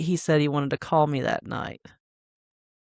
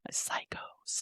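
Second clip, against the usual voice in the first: whispered; 19 dB quieter than usual. Real, not acted. Aside about exes.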